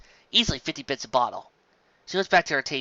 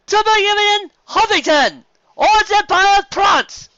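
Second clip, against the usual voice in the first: slow, high, loud announcer; slow, loud stage announcer; 11 dB louder, slower than usual. A narrator's reading. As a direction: call the names slowly and loudly from the stage. Announcer reading results.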